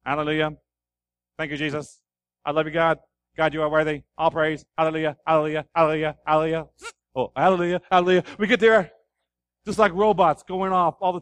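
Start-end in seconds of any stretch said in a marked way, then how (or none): 0.0-8.2 a hint of mechanical chanting praise; robotic praise. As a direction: repeat it like a programmed robot.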